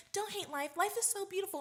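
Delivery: bright, high and sugary; mock sweet positivity. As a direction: imitate a chirpy optimist friend. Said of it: "And she was like".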